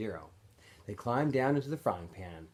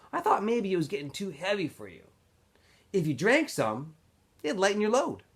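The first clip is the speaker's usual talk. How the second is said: a hint of a higher boyish voice, sly and needling; lightly voiced Zero, teasing; 9 semitones higher, 4 dB louder than usual. Zero needling Stanley about not drinking, lightly voiced, sly. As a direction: prod your friend gently about the heavy jars.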